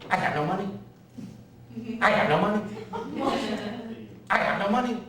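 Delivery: put-on zoned-out old woman, pitch lifted on a looping phrase; Aunt Lil. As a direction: repeat it like a confused nursing-home patient. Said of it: "my Aunt Lil would sit there like this".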